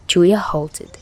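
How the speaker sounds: plain reading voice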